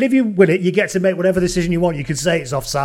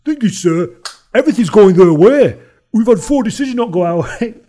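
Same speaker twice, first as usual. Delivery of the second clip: louder mock-polite complaint; a sarcastic captain having a go at the ref; sarcastic player complaint; 5 semitones higher, 7 dB louder than usual. Captain complaining to the referee, mock-polite sarcastic whinge. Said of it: "he goes".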